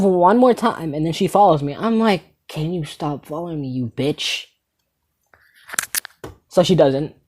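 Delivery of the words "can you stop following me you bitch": hushed exasperated insult; muted irritation. Retold or imitated. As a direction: say it under your breath, annoyed. Second, fed-up request to follower.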